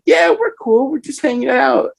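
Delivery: very high, innocent fake-casual voice. Caught dragging a passed-out friend, squeaky fake calm. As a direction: play it cool while obviously caught. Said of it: "and I said".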